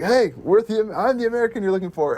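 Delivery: mock heroic quote. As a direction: step up proudly.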